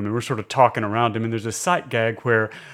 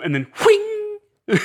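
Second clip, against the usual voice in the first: imitated whoosh; slower than usual. Imitating the glass spinning.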